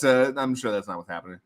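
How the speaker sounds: normal talking voice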